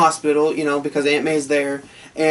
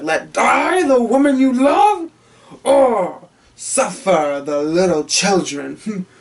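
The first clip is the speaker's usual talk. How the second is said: slow, higher villain snarl; Green Goblin; 3 semitones higher, slower than usual. Retold or imitated. Goblin taunting Spider-Man on the bridge, slow villain voice. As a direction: relish the cruel choice, drawn out. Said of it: "he's like".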